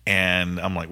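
usual speaking voice

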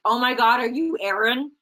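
sudden gasp of recognition, retold; surprised exclamation. Staffer suddenly realizing who she is, surprised and loud.

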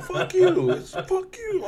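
high, loud and laughing; laughing mock quote. Quoting the church insult while laughing, high and loud.

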